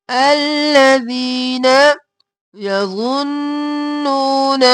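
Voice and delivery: very slow, each syllable held long; slow tajweed recitation. Shown as a demonstration. Teacher modelling الذین یظنون for students, slowly chanted.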